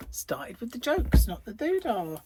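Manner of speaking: usual speaking voice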